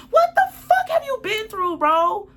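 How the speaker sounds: high-pitched, loud, incredulous yell; real angry outburst, voice shooting way up; outraged shout. Real, not acted. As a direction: explode at an ex in disbelief. Angry reply to ex's text message.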